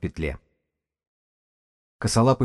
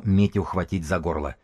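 ordinary delivery, nothing marked

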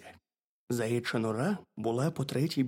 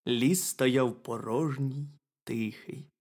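plain reading voice

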